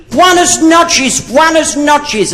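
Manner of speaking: high, slow, sing-song Spanish greeting; imitating Spanish greeting. Comedian imitates the Spanish locals greeting him with buenas noches. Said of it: "everybody kept saying to me".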